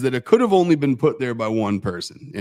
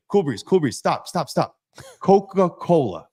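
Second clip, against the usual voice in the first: exasperated correction; 6 semitones higher than usual. Retold or imitated. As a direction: stop him and spell it out. Boss corrects the misunderstanding.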